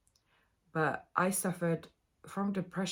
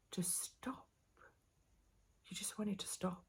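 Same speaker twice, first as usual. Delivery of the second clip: very soft, nearly whispered; real, drained near-whisper; hushed and weary; 3 semitones higher, 11 dB quieter than usual. Real, not acted. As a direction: let the voice sink almost to a whisper, with a pause between. Weary confession of a dark time.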